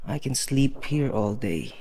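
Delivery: ordinary delivery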